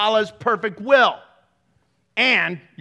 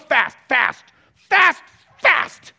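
emphatic repetition; 5 semitones higher, 6 dB louder, much slower than usual. Acted. Preacher hammers repeated command.

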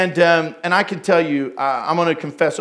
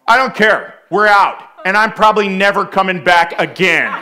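loud, angry re-enacted outburst; scary voice; 2 semitones higher, 6 dB louder than usual. Dad storming out on apologetic girl, loud scary voice. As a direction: let them have it, hitting every phrase. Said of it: "the voice that you used was your scary voice".

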